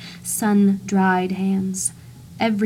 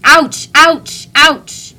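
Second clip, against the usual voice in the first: much higher, loud mock yelps; yelps of pain; 7 semitones higher, 12 dB louder, much slower than usual. Narrator yelps as imagined bullets hit him. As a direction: yelp at each imagined bullet.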